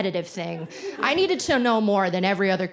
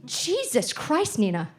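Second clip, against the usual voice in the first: mock horror from a fed-up mother; disgusted mom; 5 semitones higher than usual. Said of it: "she's just like".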